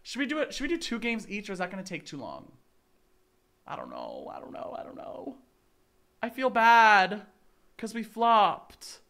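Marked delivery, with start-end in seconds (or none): 3.7-5.4 fast whispered mumble. Real, not acted. Unsure about playing more, fast whisper. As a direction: whisper it quickly to yourself.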